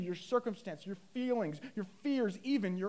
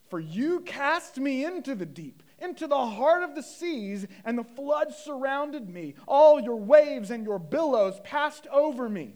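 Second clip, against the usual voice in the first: raised, louder preacher's reading; impassioned scripture reading; 6 semitones higher, 8 dB louder than usual. Acted. Preacher reading Jonah's prayer to the congregation, impassioned. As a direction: declaim the verse to the congregation. Reads scripture at full force.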